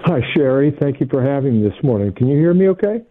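muffled, hollow phone line; in a tunnel. Real, not acted. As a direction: sound like you're in a tunnel. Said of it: "You sound like you're in a tunnel".

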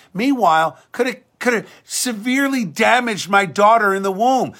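normal talking voice

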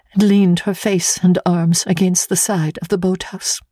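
ordinary delivery

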